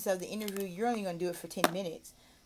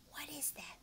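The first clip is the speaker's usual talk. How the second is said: whispered question; hushed curiosity; 5 semitones higher, 16 dB quieter than usual. Shown as a demonstration. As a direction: whisper to draw attention. TPR demo.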